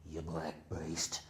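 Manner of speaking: slow, low, voiced threat; slow low menace. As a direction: drag out each word low. Boss attacking the man.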